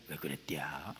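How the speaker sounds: low, soft Chungcheong drawl on 왜 그랬디야; Chungcheong accent. Shown as a demonstration. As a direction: drop low and lazy like a Chungcheong speaker. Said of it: "충청도는 좀 낮잖아요".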